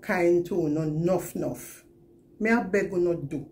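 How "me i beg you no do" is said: ordinary talk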